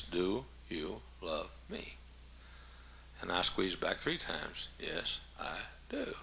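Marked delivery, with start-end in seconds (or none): 0.0-2.0 very slow, hushed question, word by word; low and soft, one word per hand squeeze; tender. Retold or imitated. Voicing a hand-squeeze love code. 4.8-6.2 slow, hushed answer, word by word; low and soft, one word per squeeze; tender. Retold or imitated. Voicing a hand-squeeze love code.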